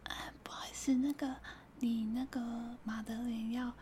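unvoiced timid cashier whisper; tiny whisper. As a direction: whisper the question, almost inaudible. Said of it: "他讲话就是这么小声". (店员细若蚊声的耳语)

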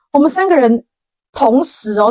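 normal talking voice

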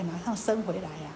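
usual speaking voice

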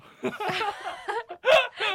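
very high, loud laugh that breaks toward crying; laughing to tears. Real, not acted. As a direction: laugh till it almost cracks. Boyfriend's crying-laughing emoji recalled. (笑到快哭出来)

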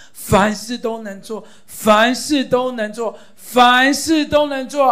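slow rising crescendo of faith; building loud declaration. Shown as a demonstration. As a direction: build from firm to full proclaiming shout. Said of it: "你要大声的来读".

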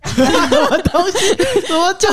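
high, laughing "what is that"; baffled, cracking up; laughing disbelief. Real, not acted.

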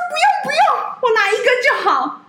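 very high-pitched imitated protest; mock polite refusal. Principled colleague turns down the freebies, high mock protest. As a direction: squeak out a modest refusal in a high voice.

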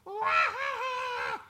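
high shrieking witch cackle; evil witch cackle. Shown as a demonstration. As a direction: cackle like a witch casting a spell. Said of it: "she uses her magic with her laughter".

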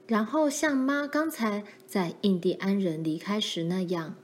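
ordinary delivery

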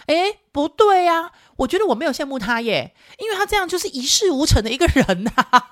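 高声学女儿装大人口气; 学女儿老气横秋地下结论; 小大人的女儿. Retold or imitated. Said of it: "我女儿讲话比较小大人一点". (用高音模仿小大人女儿的语气)

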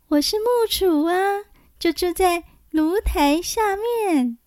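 thin high little voice. A narrator's reading. Xiyao voiced by narrator.

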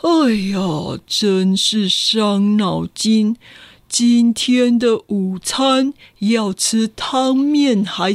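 very slow pondering; man agonizing over lunch, slow and drawn out; fretting slowly. Acted. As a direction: ponder lunch like a grave problem. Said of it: "杏仁先生说".

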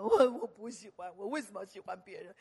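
lightly voiced whiny girl; a hint of the complaining girl; imitating the girl. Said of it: "接到这种电话". (轻声模仿抱怨的妹妹)

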